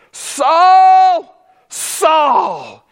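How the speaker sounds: loud, high calling out of a name; emphatic double call. Acted. Jesus calling Saul, loud and emphatic. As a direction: call the name twice with force. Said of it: "It was emphatic".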